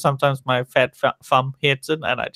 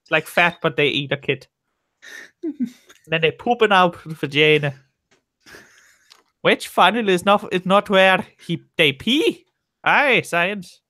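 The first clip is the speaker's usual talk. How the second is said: a put-on oddball Scottish-sounding accent, pitched much higher in spots; weird Scottish-ish voice; 5 semitones higher than usual. Voicing the man on the playground, weird Scottish-sounding voice. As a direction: go goofy and strange, half-Scottish. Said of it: "how do you get the fact that he's Scottish?".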